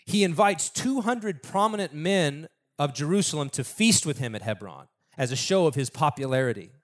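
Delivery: ordinary delivery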